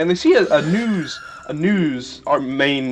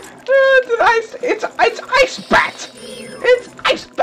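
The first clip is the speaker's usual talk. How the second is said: much higher squeals, slow and airy on Ice Bat; real scared yelp; slower than usual. Real, not acted. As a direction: panic at the sudden bat. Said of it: "You saw me become a scared man".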